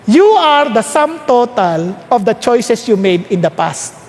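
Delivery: said slowly, word by word; slow emphatic declaration; slow, deliberate. Shown as a demonstration. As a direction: lay each word down slowly for the room. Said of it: "pakisabi mo sa katabi mo".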